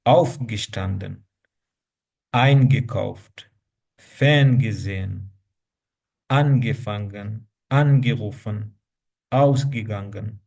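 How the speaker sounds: Aufgestanden with the weight on Auf, slow and firm; stress on the prefix. Shown as a demonstration. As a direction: lean on the prefix of each verb, slowly. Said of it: "आप stress वो prefix पे देते हैं". (हर शब्द में prefix पर ज़ोर, धीरे-धीरे)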